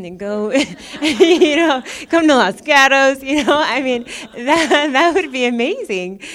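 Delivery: amused outburst, words riding on laughter; laughing. Real, not acted. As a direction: crack up at your own grand idea. Laughing at own conquering fantasy.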